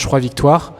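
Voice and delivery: normal talking voice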